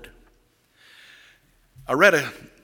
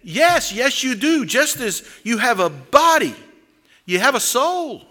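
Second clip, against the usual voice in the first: higher, louder parson pressing his point; a touch of the parson losing patience; frustrated parson; 8 semitones higher, 4 dB louder than usual. Retold or imitated. New parson to the village idiot, mildly exasperated.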